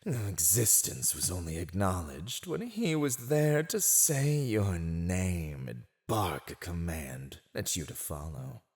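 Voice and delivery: ordinary talk, nothing marked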